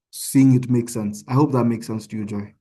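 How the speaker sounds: normal talking voice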